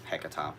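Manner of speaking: Hecata as HEK-uh-tah, low and unsure; alternative pronunciation. Shown as a demonstration. Witch unsure of another form of the name, alternative pronunciation. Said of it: "I don't know".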